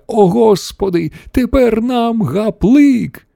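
high, drawn-out lament; despairing exclamation. A narrator's reading. Wonka announcing they are doomed, slow high exclamation. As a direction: wail it slowly, high with doom. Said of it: "вигукнув містер Вонка".

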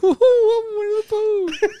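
very high, slow Disney bear voice; Winnie the Pooh. Acted. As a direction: hoot it high like a cartoon bear. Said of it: "Including the Disney voices".

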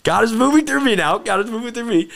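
high-pitched, emphatic and thrilled; excited exclamation. Real, not acted. Speaker testifies God is moving through them, excited exclamation. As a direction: exclaim it high and loud, carried away.

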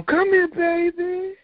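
high, slow, tender call; sweet old lady. Retold or imitated. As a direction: coo it like a kind church mother. Sweet church lady welcoming him.

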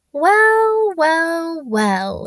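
creepy sing-song gotcha; menacing whisper, voiced. A narrator's reading. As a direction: gloat slyly, each word stepping down. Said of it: "he whispered".

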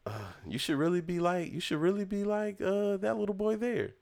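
a parent's high, fawning voice, pitched well up; admiring parent. Retold or imitated. Neighbour parents praising the boy to their kids, high and admiring. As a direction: gush like a proud neighbour pointing out the good boy.

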